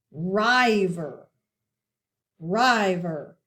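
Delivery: River said as "RYE-ver", long i; River with first syllable rhyming with "dive", drawn out; long-i misreading. Shown as a demonstration. Teacher shows class the wrong coding, long-i River. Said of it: "if we coded it like this".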